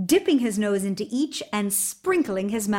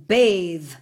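one loud barked word; curt command; 6 dB louder than usual. Acted. Tsar commanding Ivan into the cauldrons, loud and curt. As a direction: order him in like a tyrant. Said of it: "the Tsar commanded".

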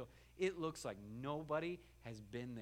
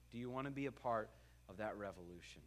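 normal talking voice

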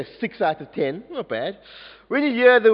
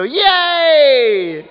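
a loud, high yay; cheer; 6 semitones higher, 6 dB louder than usual. Shown as a demonstration. Teacher shows the class the David cue, loud high cheer. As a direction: cheer like the crowd. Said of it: "I want you to go".

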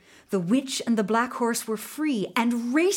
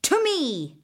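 high summoning call; commanding call; 6 semitones higher than usual. A narrator's reading. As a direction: call the horse to her. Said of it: "the witch ordered".

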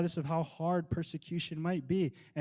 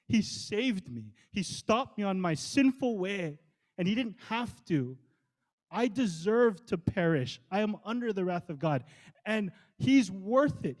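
impassioned testimony; 4 semitones higher than usual. Retold or imitated. Preacher testifying as Saul that Christ saved him, impassioned. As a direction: testify with rising conviction, stretching the key words.

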